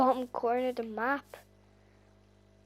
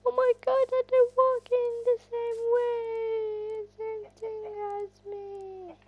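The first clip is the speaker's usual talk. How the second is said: very high, slow, teary squeal; excited squeal; 12 semitones higher, 3 dB louder, slower than usual. Real, not acted. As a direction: gush with overwhelmed joy. Chickens following her.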